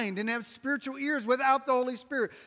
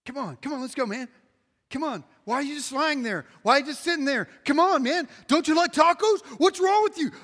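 mock exasperated pleading; 5 semitones higher, 7 dB louder, faster than usual. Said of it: "you're like".

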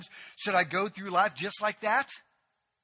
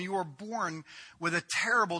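ordinary talk

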